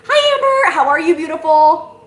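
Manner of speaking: high, loud gushing hello; excited greeting. Real, not acted. Welcoming a viewer on the livestream, high excited hello. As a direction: gush over a viewer like a friend.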